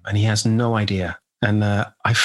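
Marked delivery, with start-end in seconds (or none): none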